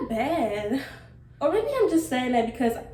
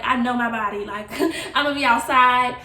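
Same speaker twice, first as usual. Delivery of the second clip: louder, cocky, laughing through it; laughing boast; 8 dB louder than usual. Retold or imitated. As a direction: brag to the doctor, laughing. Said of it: "I'm like".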